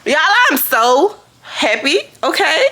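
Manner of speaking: high, swooping happy delivery; excited, rising at the end; giddy excitement. Real, not acted. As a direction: gush to viewers, pitch leaping upward. Announcing happiness to viewers.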